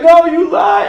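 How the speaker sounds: put-on high, loud, hurt child's cry; hammed-up betrayed nephew; wailing kid. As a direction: wail the accusation like a small kid. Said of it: "he was like".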